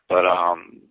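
ordinary talk, nothing marked

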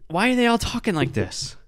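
high, baffled disbelief; can't believe what he's seeing; incredulous. Real, not acted. Baffled by kids' videos.

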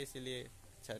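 normal talking voice

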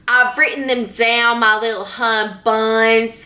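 slow Deep South drawl; Deep South accent. Shown as a demonstration. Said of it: "See, I can do it".

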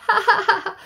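high, loud hammed-up jeering laugh; mocking laugh. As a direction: laugh him off the floor. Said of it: "The animals all laughed".

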